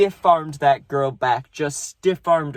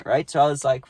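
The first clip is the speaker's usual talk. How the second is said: normal talking voice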